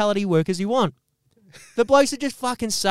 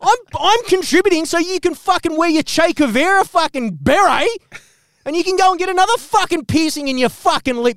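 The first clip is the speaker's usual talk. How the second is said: higher, louder ranting tirade; fired-up rant; 9 semitones higher, 5 dB louder than usual. Real, not acted. Ranting at hospitality workers, higher and louder. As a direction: build into a furious rant. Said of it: "I'm getting fired up".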